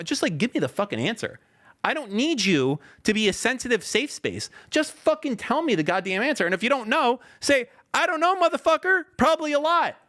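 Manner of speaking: high-pitched rant building to a shout; exasperated rant. Real, not acted. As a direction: climb from frustration into full yelling.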